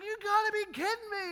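loud, high imitation of an irate friend; retold yelling. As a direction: mimic him yelling across the table. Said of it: "He starts yelling about stuff".

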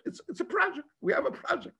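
high, small mock voice; mocking imitation. Mimics people pitching Mashiach as project.

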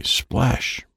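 ordinary delivery, nothing marked

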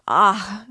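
loud, high frightened "ah"; cry of terror. A narrator's reading. Sonia hears the police are after him, cries out in terror. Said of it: "Sonia cried out in terror".

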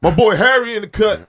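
loud, high hype call; excited shout-out. Real, not acted. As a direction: call him out loud and happy. Shouting out a friend.

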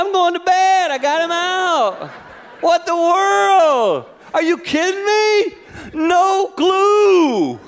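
high, loud, drawn-out what the world; exasperated disbelief. Retold or imitated. Preacher telling the congregation the punchline, high and slow in disbelief. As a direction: throw up your hands, stretched out.

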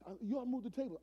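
high-pitched mock agitation; worked up. Gang leader pressing the pastor, retold as worked up. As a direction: press the demand like a riled street leader, not loud. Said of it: "He's really worked up".

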